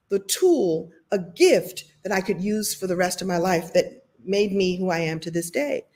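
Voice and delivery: usual speaking voice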